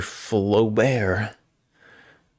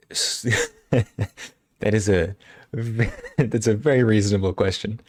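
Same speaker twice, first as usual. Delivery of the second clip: laughing; 2 semitones higher than usual. Real, not acted. Laughing through a reply.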